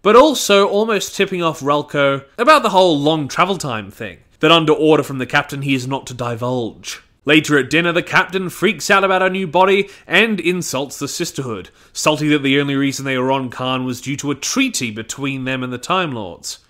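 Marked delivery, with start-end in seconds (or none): none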